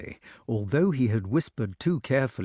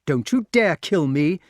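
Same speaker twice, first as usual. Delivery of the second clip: loud, high-pitched defiance; crying out; 5 semitones higher, 6 dB louder than usual. A narrator's reading. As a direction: shout a desperate warning. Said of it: "cried the wart".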